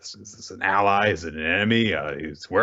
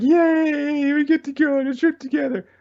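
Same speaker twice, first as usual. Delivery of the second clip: very high, louder excited squeal; high squeaky excited cheer; giddy Denji; 4 dB louder than usual. Retold or imitated. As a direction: gush like a kid promised a trip. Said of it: "Denji's immediately like".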